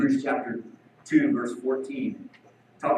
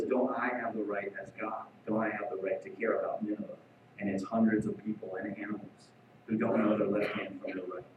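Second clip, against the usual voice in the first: softer, a hint of God reasoning tenderly; gentle quiet reply; 7 semitones lower, 8 dB quieter than usual. God answers sulking Jonah, soft and gentle. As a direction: let it fall soft and patient.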